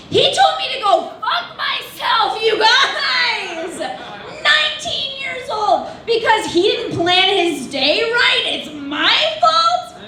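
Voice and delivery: high, drawn-out indignant wail; mock outrage. Comic telling an audience of a customer's insult, mock outrage.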